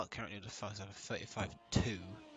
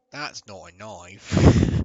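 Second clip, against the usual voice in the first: loud, slow, hammed-up Australian; Australian accent; 18 dB louder, much slower than usual. Shown as a demonstration. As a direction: draw it out in a big mock Australian accent. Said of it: "do an Australian accent".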